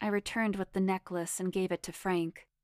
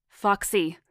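mild shout, louder than usual; 8 dB louder than usual. A narrator's reading. Madoff shouting to Foxy over the speaker phone, shouting. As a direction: call out the name loudly. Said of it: "I heard someone shout".

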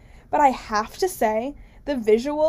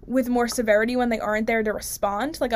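ordinary delivery

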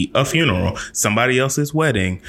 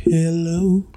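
put-on greeting; 6 semitones higher than usual. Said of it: "grab hold of life and be like".

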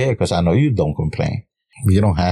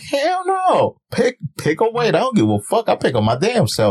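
defiant retort; 13 semitones higher than usual. Retold or imitated. Retelling her defiant answer to friends.

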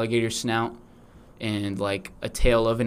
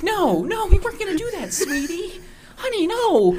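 much higher mock mother; mom voice. Mom reassures her alligator baby in falsetto. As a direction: soothe him in a falsetto mom voice. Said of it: "the mom's like".

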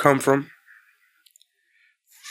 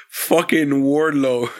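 usual speaking voice

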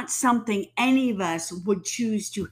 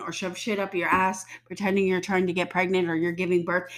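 ordinary talk, nothing marked

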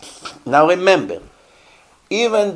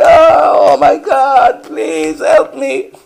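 hammed-up wailing, much higher, louder and slow; mock crying; 11 semitones higher, 6 dB louder, slower than usual. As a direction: fake sobbing plea drawn out high. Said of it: "Begins to cry".

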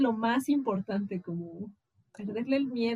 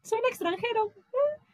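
mock listener; 11 semitones higher than usual. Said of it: "sonará como".

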